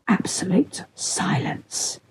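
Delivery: slow, emphatic stage whisper. A narrator's reading. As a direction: whisper it slowly like a warning sign. Reading out the set's silence sign.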